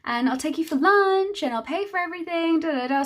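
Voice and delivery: high, sugary mock friend voice, pitched well up; imitating May. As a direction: mimic her empty promises in a lifted voice. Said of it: "May had said".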